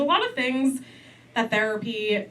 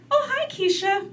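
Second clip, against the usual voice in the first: mock sweet auntie hello, higher; imitating auntie's polite hello, pitched up; auntie's greeting; 12 semitones higher than usual. Auntie greeting niece at the door, lightly mocked higher. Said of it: "doesn't look at me and just say".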